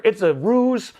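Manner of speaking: ordinary delivery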